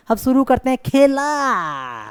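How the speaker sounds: show-style call with खेला drawn way out; stress on खेला. Retold or imitated. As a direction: stretch खेला long, then let it fall. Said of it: "word stress की वो बात करता है ऐसे करके". (खेला को लंबा खींचकर ज़ोर)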